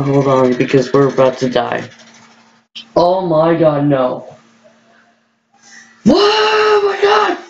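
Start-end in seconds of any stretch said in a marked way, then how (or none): 6.0-7.4 very high, drawn-out screaming cry; terrified scream. Real, not acted. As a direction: scream through the drop. Big coaster drop.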